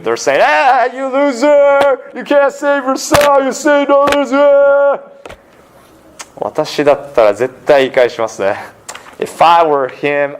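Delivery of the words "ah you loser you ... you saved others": much higher, slow sneering taunt; mocking jeer. Crowd taunting Jesus on the cross, slow sneering jeer. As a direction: drag out the insults like a jeering mob. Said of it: "they're laughing at him too".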